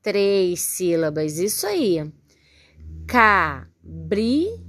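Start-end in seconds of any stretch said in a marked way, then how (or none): 3.1-4.6 "Cabrita" slowly split into ca, bri, ta; syllable by syllable. Shown as a demonstration. Teacher counting syllables for pupils, "Cabrita" syllable by syllable. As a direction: count out "Cabrita" with a pause after each syllable. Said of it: "quantas sílabas a gente tem".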